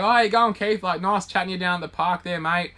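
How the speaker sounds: high, loud mock-cheery greeting; sing-song fake friendly hello to the thief. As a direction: sweeten it up like an old mate. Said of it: "I sort of did the whole".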